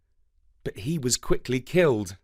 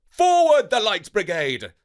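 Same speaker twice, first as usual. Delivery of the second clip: loud, high battle cry; rally the cavalry with a shout; commanding shout; 8 semitones higher, 6 dB louder than usual. Acted. Commander ordering the brigade into the charge, loud shout. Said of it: "he said".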